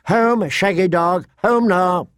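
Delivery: put-on high child's command, restrained volume; mild scream. A narrator's reading. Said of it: "Rickon remembered himself and screamed".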